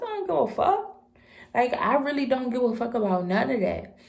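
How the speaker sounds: defiant yell. Retold or imitated. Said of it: "He started yelling at me".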